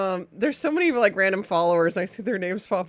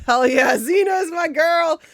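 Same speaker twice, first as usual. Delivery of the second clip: louder, higher, slow proud shout; cheering shout; 7 semitones higher, 6 dB louder, slower than usual. Real, not acted. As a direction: cheer it out for your favorite. Cheering a favorite game.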